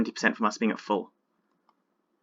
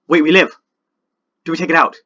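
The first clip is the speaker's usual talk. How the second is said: sudden loud disbelief; startled shout of hope; shocked; 3 semitones higher, 13 dB louder, faster than usual. Real, not acted. Surprise mid-battle win chance.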